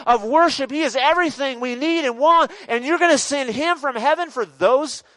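high, frantic protest from an angel; Gabriel. Acted. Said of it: "Gabriel's up there going".

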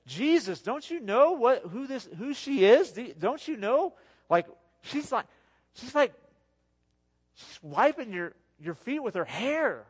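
high, loud shocked scolding of the crowd; indignant outcry. Retold or imitated. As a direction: cry out in loud, high-pitched outrage at Jesus. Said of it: "that's exactly what the, everybody there said".

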